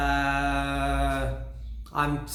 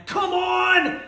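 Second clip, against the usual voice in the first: high, loud shouted come on; imitated shout; 8 dB louder than usual. Fan imitating the singer's shout in Freedom, loud. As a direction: scream it like the frontman. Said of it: "has lots of".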